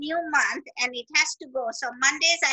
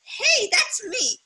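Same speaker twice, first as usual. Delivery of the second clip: excited recognition; 7 semitones higher, much slower than usual. Retold or imitated. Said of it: "I was like".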